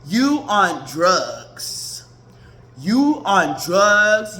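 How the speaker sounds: drawn-out, deliberate accusation; slow pointed verdict; slow emphatic. Real, not acted. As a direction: spell it out slowly. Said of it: "slow emphatic accusation".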